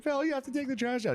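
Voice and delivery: nagging mom. Retold or imitated. Mom telling dad about the trash, mock nagging. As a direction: imitate mom's high-pitched nagging. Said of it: "My dad called my mom a dumbass".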